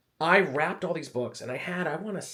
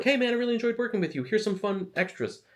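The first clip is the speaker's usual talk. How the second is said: cheery editor voice; 10 semitones higher than usual. Retold or imitated. Voicing friendly editor in story.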